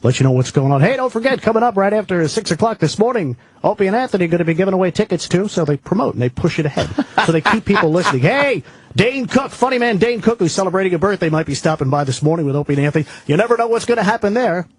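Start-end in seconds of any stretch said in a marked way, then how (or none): 0.8-5.5 upbeat overnight DJ promo read; radio DJ voice. Shown as a demonstration. 8.3-14.7 upbeat overnight DJ promo read; radio DJ voice. Shown as a demonstration. Continues DJ promo demo.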